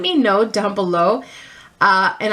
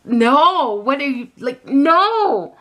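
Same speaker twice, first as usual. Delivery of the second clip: raised pitch on a horrified no; appalled protest; 6 semitones higher, 4 dB louder, much slower than usual. Retold or imitated. Daughter retells her look as dad offers espresso, appalled protest. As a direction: silently beg dad to stop, out loud. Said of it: "giving my dad this look like".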